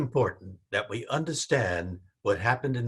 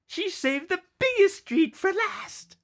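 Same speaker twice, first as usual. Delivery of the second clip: put-on much higher, louder child's voice; excited child; 4 dB louder than usual. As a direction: tease like a thrilled little kid. Excited child about mother's bag.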